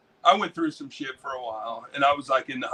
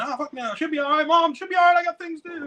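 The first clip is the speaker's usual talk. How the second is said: much higher, careless brush-off; younger self brushing off; 13 semitones higher, 3 dB louder, faster than usual. Retold or imitated. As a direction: wave mom away, carefree.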